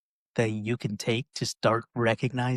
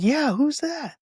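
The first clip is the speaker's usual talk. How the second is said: much higher, louder; called-up question; 5 dB louder, slower than usual. Retold or imitated. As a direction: shout up from the hole. Man in hole asks.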